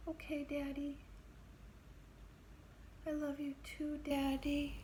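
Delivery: put-on high, sweet good-girl voice, fading to a whisper; little girl voice. Girl assuring her jailed father she's good, high and babyish. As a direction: sound like a much younger, eager-to-please child. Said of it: "It's so different".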